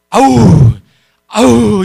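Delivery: frustrated groan. Retold or imitated. Sixth grader holding back anger.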